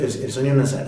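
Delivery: usual speaking voice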